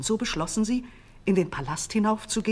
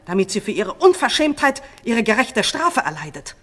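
high, much louder, fast vengeful cry; furious shout; 6 semitones higher, 12 dB louder than usual. A narrator's reading. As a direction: demand the stake in a rage. False queen demands execution.

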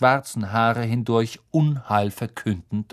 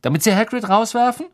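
fast, high, louder question; angry accusation; 10 semitones higher, 5 dB louder, faster than usual. A narrator's reading. Harry challenges Malfoy's scheme, fast indignant question.

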